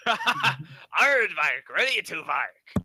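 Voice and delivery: a giggly imitation of a Scottish dwarf; Scottish accent. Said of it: "Let me hear your best dwarven Scottish accent".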